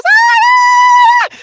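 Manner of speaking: long, loud, piercingly high scream; imitated scream. Friend hears he moved to Woodstock, screaming. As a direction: mimic a friend's excited shriek at full volume. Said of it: "And she screamed".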